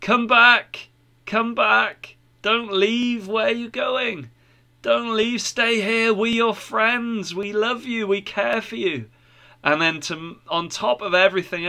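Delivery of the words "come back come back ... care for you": high-pitched calling after someone; mild shouting. A narrator's reading. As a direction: call them back, pleading, pitch well up. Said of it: "Others started shouting".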